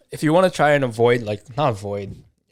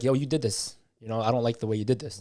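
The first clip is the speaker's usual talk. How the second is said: quicker, pointed and blaming; blunt accusing tone; 5 dB quieter than usual. Shown as a demonstration. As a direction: fire it at them like a complaint. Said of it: "Instead of, like".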